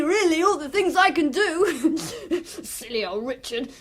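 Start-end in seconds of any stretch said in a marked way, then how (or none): 2.4-3.7 gloating giggle. Acted. Boy gloating over the killing.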